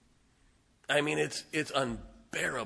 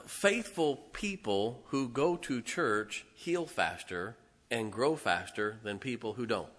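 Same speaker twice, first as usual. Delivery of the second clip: usual speaking voice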